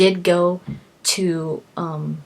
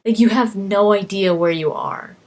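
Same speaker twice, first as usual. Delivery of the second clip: ordinary talk, nothing marked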